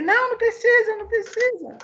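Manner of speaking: high-pitched, insistent polite protest; protesting refusal. Retold or imitated. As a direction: jump up high to wave the offer away. Politely refusing gift in retold exchange.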